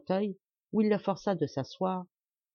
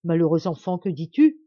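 alarmed exclamation; 9 dB louder than usual. A narrator's reading. Priest alarmed by confession.

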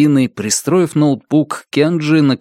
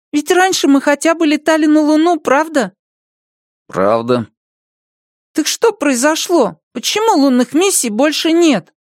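put-on child voice. Boy asks.

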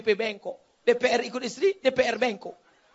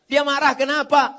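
loud, raised-pitch challenge; forceful rhetorical question; 4 semitones higher, 6 dB louder than usual. Real, not acted. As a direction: throw the question loudly at the crowd. (pertanyaan lantang menantang)

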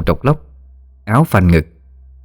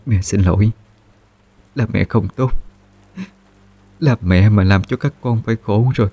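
choked up. Acted. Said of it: "giọng nghẹn ngào".

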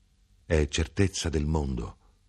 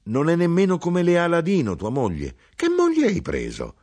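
high, loud scornful mother; mock the son's choice of wife; 10 semitones higher, 4 dB louder than usual. Mother to son, scornful and high.

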